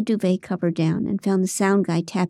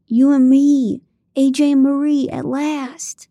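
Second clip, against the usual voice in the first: put-on higher, louder eager boyfriend voice; gushing AJ; 6 semitones higher, 6 dB louder than usual. A narrator's reading. AJ gushing to Marie under the sheets, higher and louder. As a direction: swoon over finally getting her.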